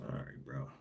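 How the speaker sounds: under-the-breath whisper; whispered aside. Real, not acted. Aside to himself about the guest, whispered. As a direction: drop to a whisper to yourself. Unvoiced aside after a quote.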